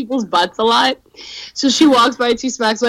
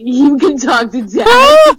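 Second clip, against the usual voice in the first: laughing loud tease; 5 semitones higher, 6 dB louder, slower than usual. Real, not acted. Teasing, laughing shout.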